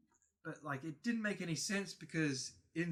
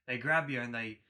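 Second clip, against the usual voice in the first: ordinary delivery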